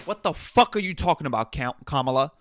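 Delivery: loud, fast, disbelieving swearing; real frustration at the clip; exasperated outburst. Real, not acted. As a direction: blurt it out, fed up. Outraged reaction to the clip.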